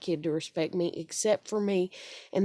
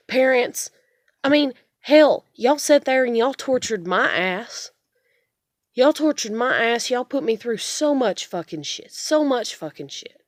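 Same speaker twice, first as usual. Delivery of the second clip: raised, heated voice at her parents; angry rant; 4 semitones higher, 5 dB louder than usual. Real, not acted. Daughter ranting at her parents who tortured her, angry and loud. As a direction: let it build into a loud, bitter rant.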